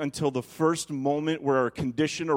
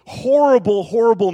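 much higher and loud burst; exaggerated horrible; 7 semitones higher, 10 dB louder than usual. Describing the baby's crying, a loud high exclamation. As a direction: blurt it out with big emphasis.

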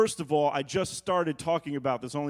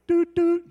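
high, loud imitated beeps; call waiting beep; 9 dB louder than usual. Preacher imitating the call waiting tone, high loud beeps. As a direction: sing out two beeps much higher and louder.

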